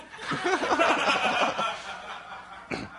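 a high breathy laugh at his own punchline; laughter. Real, not acted. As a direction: burst out laughing.

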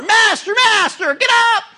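high, loud frantic plea to wake him; panicked cry. Acted. Disciples shouting to sleeping Jesus, high panicked cry. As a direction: disciples crying out in terror.

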